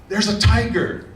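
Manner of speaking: whisper-urgent alarm to a friend; alarmed monkey. Acted. Monkey warning the rabbit about the tiger, alarmed.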